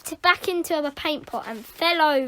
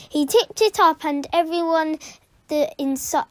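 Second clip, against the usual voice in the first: ordinary delivery, nothing marked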